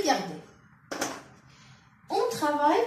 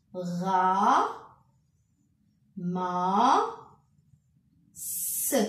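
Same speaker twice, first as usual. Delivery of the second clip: ra, ma, s spaced apart with long pauses; syllable by syllable; 4 semitones lower, much slower than usual. Shown as a demonstration. A teacher sounding out syllables to pupils, slow and separated. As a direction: break ramassent into separate syllables. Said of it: "je déchiffre la phrase par syllabe".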